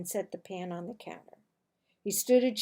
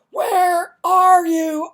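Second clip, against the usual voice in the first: slow, booming shout, much louder and higher; booming call; 7 semitones higher, 15 dB louder, much slower than usual. Acted. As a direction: boom it so it fills every room. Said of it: "My father's voice was booming".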